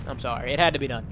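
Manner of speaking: ordinary delivery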